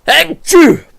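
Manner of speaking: big real sneeze; loud sneeze. Real, not acted. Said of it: "I'm gonna sneeze".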